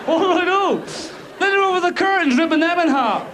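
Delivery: high, whiny mock-wounded cat; hammed-up high pitched diva; sulky cat voice. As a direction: play a moody cat in a huff.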